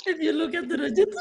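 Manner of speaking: words squeezed out through laughter; can barely talk for laughing; laughing uncontrollably. Real, not acted. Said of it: "When I try to control my laugh".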